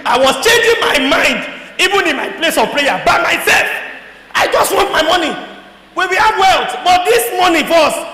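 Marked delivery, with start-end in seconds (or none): 4.3-8.0 raised, louder, half-laughing demand; mock greedy outburst. Preacher mimicking his own prayer, demanding money loudly. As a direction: insist on the money like a grabby child.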